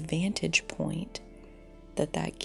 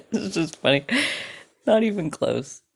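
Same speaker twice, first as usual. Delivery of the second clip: louder, amused, through laughter; laughing; 2 semitones higher, 7 dB louder, faster than usual. Real, not acted. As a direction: say it laughing at yourself. Laughing at her own claim.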